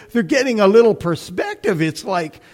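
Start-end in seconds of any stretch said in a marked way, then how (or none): none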